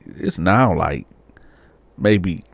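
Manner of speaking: ordinary talk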